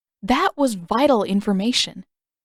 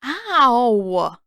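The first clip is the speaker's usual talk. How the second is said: sharp higher yelp of pain; 4 semitones higher, 4 dB louder than usual. Acted. Sokka hit in the neck by sleeping Katara, short pained yelp. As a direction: yelp as the arm smacks his neck.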